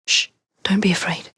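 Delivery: hushed, calming whisper; soothing whisper. Acted. Evie calms a frightened victim in a whisper. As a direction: whisper to calm a scared child.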